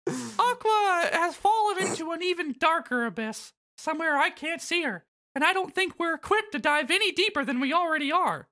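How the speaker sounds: Mickey Mouse voice. Acted. Voicing Mickey in recap.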